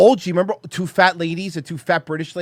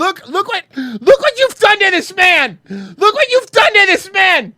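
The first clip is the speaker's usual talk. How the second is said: high, loud, accusing shout; mock outrage; 11 semitones higher, 6 dB louder than usual. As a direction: scold them in hammy outrage. Mock outrage over Emeril.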